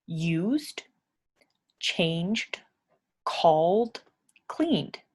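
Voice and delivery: "used, changed, called, cleaned" each ending on a clear voiced d; d sound endings. Shown as a demonstration. Teacher to English learners, modelling the voiced d ending. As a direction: lean on the final d of each verb. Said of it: "that makes a duh sound at the end".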